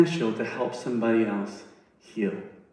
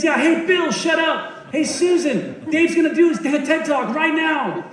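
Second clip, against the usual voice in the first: high-pitched hollering to the whole room; mock-shout at one person after another; hammed-up crowd-rousing yell; imitated yelling; 12 semitones higher than usual. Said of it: "So he's yelling".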